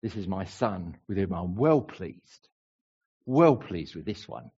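a hint of Cockney, pitched low; lightly put-on Cockney voice. Preacher playfully voicing God to the congregation, Cockney. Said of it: "saying it in a Cockney voice".

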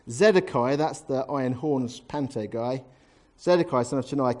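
ordinary delivery

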